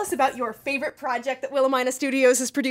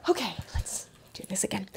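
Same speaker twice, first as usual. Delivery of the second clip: unvoiced aside to the crew; whisper; 5 semitones lower, 11 dB quieter than usual. Real, not acted. Host whispering to restart the interview.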